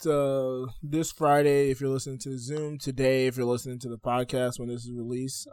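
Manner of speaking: normal talking voice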